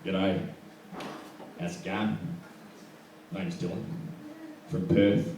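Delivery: soft, low, halting chat-up line; sidling chat-up. Acted. Sidling up to girls in nightclubs, soft mock-suave introduction. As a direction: sidle up and drop each phrase quietly. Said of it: "I found myself sidling up to girls".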